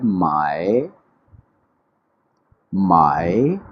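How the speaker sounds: माय dipping low then rising; माय with a falling-rising tone, held low; third tone mai. Shown as a demonstration. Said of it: "वो word क्या है?".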